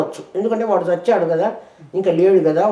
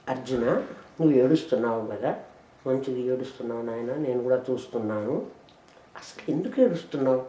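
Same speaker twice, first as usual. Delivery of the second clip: soft consoling; 8 semitones lower, 10 dB quieter than usual. Acted. Voicing Krishna to Arjuna.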